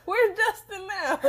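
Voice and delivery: eager question through laughter; laughing. Real, not acted. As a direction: laugh while asking. Teasing, laughing friend.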